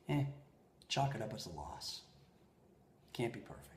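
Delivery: very soft, low reassurance; tender, hushed shrug; loving and gentle. Retold or imitated. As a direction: let them off the hook quietly, with small pauses. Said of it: "lovingly ... very, very gently".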